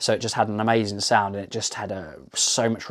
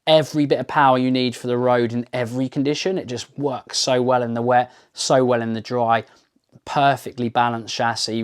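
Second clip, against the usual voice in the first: ordinary talk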